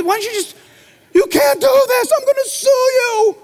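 put-on high, loud whiny teen protest; mock outraged teen. Pastor imitating a teen told to sit without a phone, high whiny outrage. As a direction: play a teenager threatening to sue. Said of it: "They would think".

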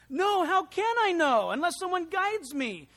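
high, loud, pleading answer; the Ethiopian pleading with Philip for a guide, loud; earnest plea. Acted. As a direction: beg to be guided.

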